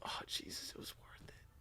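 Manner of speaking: soft, hushed tearful prayer; whispered crying prayer. Retold or imitated. David on the floor after the girl left, whispering through tears. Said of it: "crying like this and going like".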